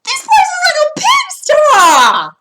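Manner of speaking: high, loud, slow outraged exclaim; exclamation. Shown as a demonstration. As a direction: shout it at the messy kitchen. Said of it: "I probably would say it like this".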